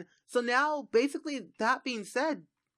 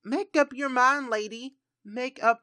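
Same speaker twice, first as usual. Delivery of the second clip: louder, higher exasperated scold; exasperated call; 5 semitones higher, 4 dB louder than usual. Acted. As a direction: throw up your hands and call it out loud. Scolding indecisive Sim.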